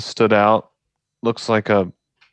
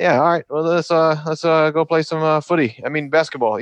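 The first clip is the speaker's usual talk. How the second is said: upbeat Australian coach voice in the huddle, pitched higher; Australian accent; 7 semitones higher than usual. Retold or imitated. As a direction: do a cheerful Aussie Joe Ingles. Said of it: "I need to work on my Australian accent".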